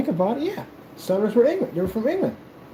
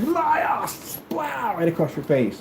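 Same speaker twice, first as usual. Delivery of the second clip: high, drawn-out mock cry; outraged duelist, hammed up; indignant old-time gentleman; 9 semitones higher, much slower than usual. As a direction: cry out a theatrical accusation. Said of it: "I would slap you in the face".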